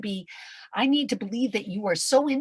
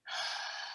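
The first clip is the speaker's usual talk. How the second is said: breathy sigh; 13 dB quieter than usual. Acted. After the whispered wake-up.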